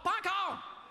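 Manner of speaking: high, loud comic outburst; emphatic punchline. Acted. Comedian saying the show is not cool yet, high emphatic shout.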